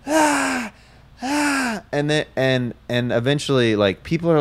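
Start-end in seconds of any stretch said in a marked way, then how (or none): 0.0-1.8 high-pitched mock child wail; drawn-out imitation of a screaming child; imitated kid's wail. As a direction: wail high like an uncalmed kid. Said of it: "They were just letting them".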